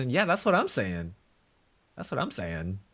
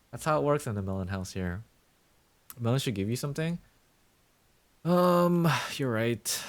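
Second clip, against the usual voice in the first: ordinary delivery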